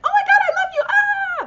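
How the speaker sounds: high, loud squealing fan gush; mock fangirl squeal. Teasing the host with a fake fangirl squeal. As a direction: shriek it like a starstruck fan, all in jest. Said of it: "kinda fangirling".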